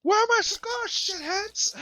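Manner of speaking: very high mock scream; hammed-up shriek at your captors; furious captive. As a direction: yell it in a squeezed, outraged falsetto. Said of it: "He's so mad".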